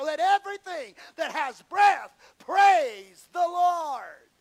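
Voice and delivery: loud, high preacher's call; full-force preaching. Acted. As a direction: lift the church with each line. Preaching climax.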